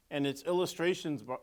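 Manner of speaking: ordinary delivery, nothing marked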